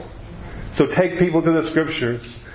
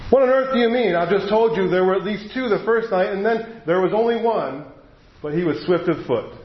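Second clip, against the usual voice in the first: incredulous protest; 5 semitones higher than usual. Acted. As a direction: blurt it out, unable to believe it. Shasta protests the lion's claim.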